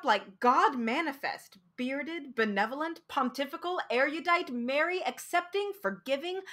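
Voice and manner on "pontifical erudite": plain reading voice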